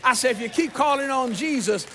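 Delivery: loud, emphatic exhortation; full-force preaching. Real, not acted. Preacher promising the congregation Jesus will pass by, loud and fervent.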